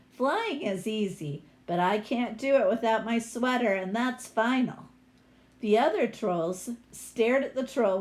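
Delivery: lively picture-book read-aloud; story reading. A narrator's reading. Teacher reading a troll story to her class, lively read-aloud.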